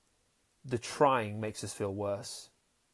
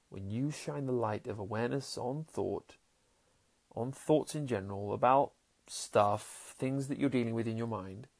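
meditative calm; 2 semitones lower, 7 dB quieter than usual. Acted. Speaker to listeners on thoughts, soft meditative guidance.

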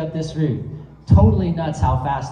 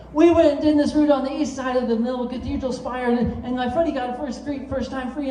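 higher, eager retelling of a psyched climber; animated climber; 8 semitones higher than usual. Retold or imitated. A psyched Camp 4 climber bragging about his route, higher and eager. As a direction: gush about the free ascent in a raised voice. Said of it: "the guy just totally animates".

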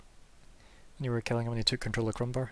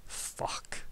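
a hushed curse under the breath; whispered aside; 9 dB quieter than usual. Acted. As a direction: mutter it to the side, barely voiced. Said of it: "says that to the side".

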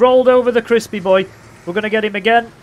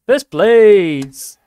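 loud, drawn-out victory call; triumphant shout; 2 semitones higher, 7 dB louder than usual. Real, not acted. Gamer winning first place, triumphant shout. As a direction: announce the win loudly. Wins the race.

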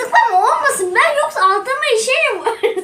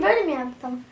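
ordinary talk, nothing marked

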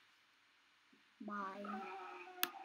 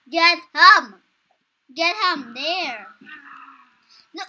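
calling out loudly; 8 dB louder than usual. Real, not acted. Calling out in game.

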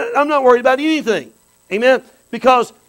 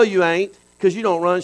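ordinary talk, nothing marked

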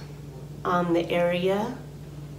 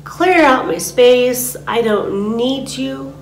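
louder and slow, commanding; firm banishing; 5 semitones higher, 8 dB louder, slower than usual. Shown as a demonstration. Teacher banishes what's in her space, loud and slow. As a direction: order it out of your space. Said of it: "you can say it out loud".

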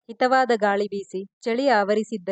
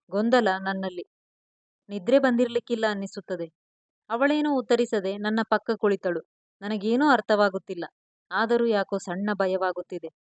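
ordinary delivery, nothing marked

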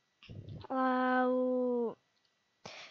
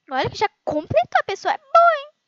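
much louder, higher exclamation of awe; amazed; 10 semitones higher, 12 dB louder than usual. Real, not acted. Gamer girl amazed that someone finished the level. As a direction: exclaim in disbelief.

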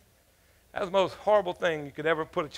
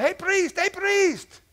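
hollering; 12 semitones higher, much slower than usual. Retold or imitated. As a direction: holler for the priest from the riverbank. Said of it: "he's hollering".

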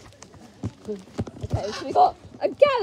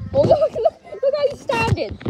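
higher, louder eager pointing; excited; 7 semitones higher, 7 dB louder than usual. Real, not acted. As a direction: urge them to look.

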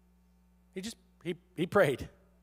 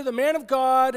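normal talking voice

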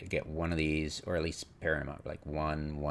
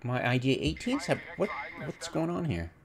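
startled realization; 8 semitones higher than usual. Retold or imitated. Gamer retelling the range surprise, alarmed. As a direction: catch yourself, alarmed. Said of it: "But then I realized".

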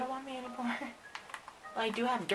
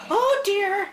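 alarmed cry; 11 semitones higher, 12 dB louder than usual. Acted. Sees a zombie.

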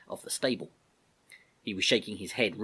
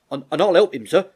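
put-on loud, raised, eager young soldier; David joining in; 8 semitones higher, 12 dB louder than usual. David volunteering to the Major, eager and loud. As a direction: jump in eagerly to back a friend.

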